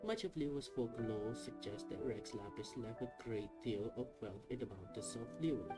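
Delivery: normal talking voice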